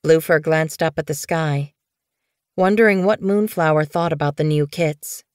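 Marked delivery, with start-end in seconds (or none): none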